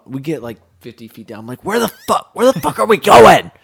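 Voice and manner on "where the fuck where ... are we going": high, loud exasperated yell; angry complaint. Retold or imitated. Lost in the drain, yelling at the guys. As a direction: bitch at them, fed up. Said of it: "I'm bitching at 'em".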